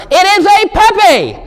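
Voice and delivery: high, careful mock child voice; kid's excuse. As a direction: insist it is a puppy for mother. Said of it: "I'm like".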